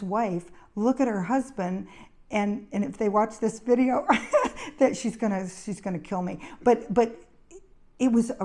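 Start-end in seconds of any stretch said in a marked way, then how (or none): 4.1-4.7 short high-pitched giggle; amused laugh. Real, not acted. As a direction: laugh at your own trouble. Amused that the wife may watch.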